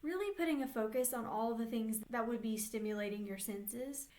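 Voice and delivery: ordinary delivery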